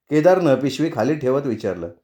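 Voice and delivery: usual speaking voice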